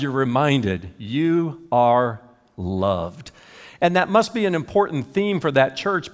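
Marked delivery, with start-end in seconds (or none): 1.0-3.3 very slow, drawn out, tender; slow, lingering reminder; slow tender sign read. Retold or imitated. Reciting the sign, very slowly.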